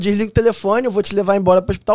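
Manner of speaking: insistent officer, retold; imitated sergeant. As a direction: act out an urgent command. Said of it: "a sargenta já tava vindo falando".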